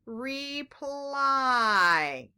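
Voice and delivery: "Reply" stretched slowly, long i in the last syllable; drawn-out word. Shown as a demonstration. Teacher asking which letter makes the sound, drawn-out word. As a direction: hold both vowels of "Reply" so the i stands out. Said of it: "which letter is making the I".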